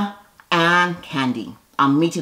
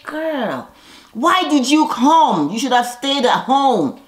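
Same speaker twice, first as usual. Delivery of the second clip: put-on higher, slow, drawn-out sassy "Girl"; sassy retort; 7 semitones higher, slower than usual. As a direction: scold her with attitude. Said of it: "And I'm like".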